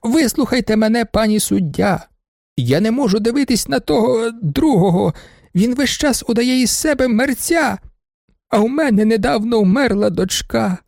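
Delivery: put-on high, aggrieved old lady's plea; pleading baroness. Baroness pleads with the judge about the student. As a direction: beg the judge, high and tearful.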